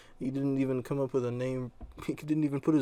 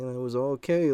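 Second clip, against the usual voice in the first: ordinary talk, nothing marked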